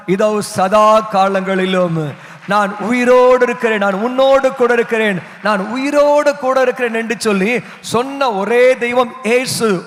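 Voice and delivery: loud, emphatic sermon climax; preacher rallying the room for an amen; full-force preaching. Real, not acted. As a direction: proclaim it loudly to the congregation.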